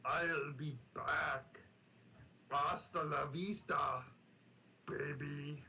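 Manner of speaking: put-on lightly done Austrian accent, slow on the last lines; a hint of Schwarzenegger's Austrian accent; Arnold quotes. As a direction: drawl the catchphrases like Arnie. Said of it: "my Arnold impression".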